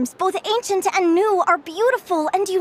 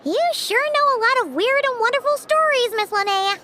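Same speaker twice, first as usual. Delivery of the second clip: put-on high, childlike wonder; admiring little companion; 9 semitones higher than usual. Little companion praising the storyteller's wonderful stories, high and admiring. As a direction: pipe up high, impressed.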